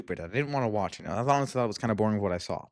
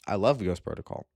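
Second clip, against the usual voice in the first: ordinary talk, nothing marked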